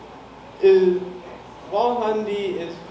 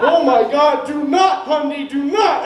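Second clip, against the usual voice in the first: raised, louder retold plea, dragged out at the end; alarmed plea; 5 semitones higher, 4 dB louder, slower than usual. Roommate begging a tripping friend not to break things, retold alarmed plea. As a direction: imitate yourself urgently begging a friend to stop.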